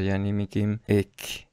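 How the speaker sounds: ordinary talk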